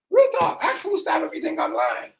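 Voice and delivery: blurting it out in high excitement; excited burst. Real, not acted. To the viewers about the girls fighting, excited high burst. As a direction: pitch jumps way up, almost squealing.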